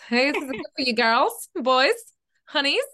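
God calling them honeys. Retold or imitated. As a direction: sweetly hand over a gift. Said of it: "that's how he talks".

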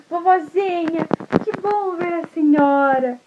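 put-on high, slow, glad child voice; relieved little girl. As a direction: greet grandma with relief. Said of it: "a Chapeuzinho falou assim".

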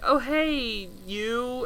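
soft, lightly put-on niceness; faux nice. Podcaster imitating a greeting to the concierge, faux nice. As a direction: greet softly with mock sweetness. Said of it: "faux nice to him".

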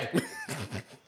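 squealing laugh; high cackle. Real, not acted. After mock shout.

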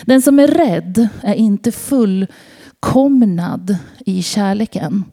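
normal talking voice

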